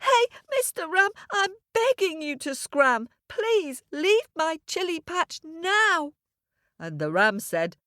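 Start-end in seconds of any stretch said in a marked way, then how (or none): 0.0-6.1 very high, wailing little boy, acted; tearful begging. Little boy begs the ram to scram, in acted tears. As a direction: beg like a small child bursting into tears. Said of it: "burst into tears and said".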